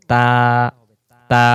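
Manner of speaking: তা with lips spread wide and a light sound; light letter with a wide mouth; thin taa. Shown as a demonstration. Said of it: "মুখটা চেওড়া হবে".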